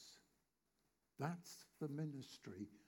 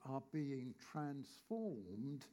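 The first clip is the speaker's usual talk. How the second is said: slow emphasis; much slower than usual. Acted. Preacher stretches key phrase.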